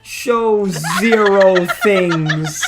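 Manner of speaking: loud, slow, laughing protest; flustered shouting refusal. Real, not acted. Flustered streamer refusing his friend, loud and laughing.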